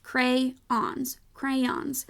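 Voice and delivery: crayons said as CRAY-ONS, both vowels full; two-syllable crayons. Shown as a demonstration. Reading teacher showing how the word should be pronounced, two-syllable crayons. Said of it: "should be pronounced".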